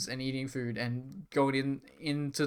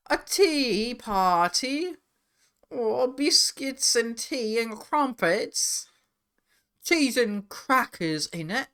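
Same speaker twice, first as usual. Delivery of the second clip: high, slow, prim English lady; posh tea party voice; 9 semitones higher, much slower than usual. Pretend. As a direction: drawl it like a fancy hostess. Mock refined tea party voice.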